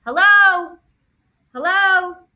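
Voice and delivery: high, loud drone hollering hello; piercing high call-out; yelling out. A narrator's reading. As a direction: holler to rouse someone who is not responding. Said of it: "then yells out".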